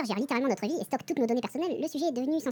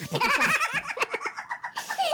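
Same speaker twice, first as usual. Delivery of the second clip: big laugh. Real, not acted. Laughing at his own slip.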